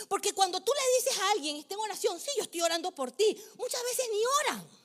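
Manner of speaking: loud, high, impassioned sermon; fiery preaching. Acted. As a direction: preach it full force to the congregation. Pastor preaching at full force.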